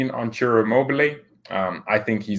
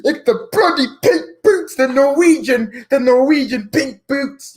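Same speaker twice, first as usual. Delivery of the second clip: very high, loud, drawn-out mock rant; Goldberg; 13 semitones higher, 5 dB louder than usual. Streamer mimicking a fan's rant, high screeching impression. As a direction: screech it like an outraged fan. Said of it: "let me do the Goldberg one".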